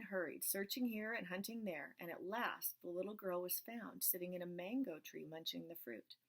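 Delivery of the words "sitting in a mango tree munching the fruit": ordinary delivery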